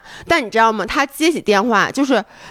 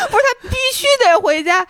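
laughing correction; 9 semitones higher than usual. Real, not acted.

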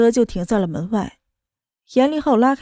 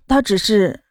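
cut off mid word; 2 semitones lower, slower than usual. A narrator's reading. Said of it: "莫渊熙打断了他的话".

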